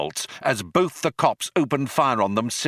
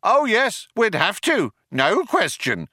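high, raised call; calling back; 12 semitones higher than usual. A narrator's reading. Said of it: "the other one called back".

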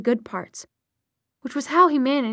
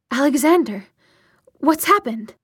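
louder, higher, urgent concern; urgent, raised voice; startled question; 3 semitones higher, 5 dB louder than usual. A narrator's reading. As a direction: ask it with sudden alarm. Startled mother greets unexpected children.

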